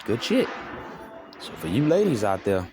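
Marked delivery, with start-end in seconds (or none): 0.0-0.5 a hint of raised, impressed voice; lightly voiced impressed bro; impressed remark. Man retelling being impressed, lightly raised. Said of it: "I mean, I was like".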